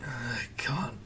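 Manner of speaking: whispered, drowsy groan; sleepy whisper. Retold or imitated. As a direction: breathe it out half asleep. Half-asleep groan at phone.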